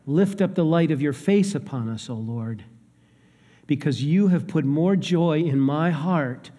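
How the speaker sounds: ordinary talk, nothing marked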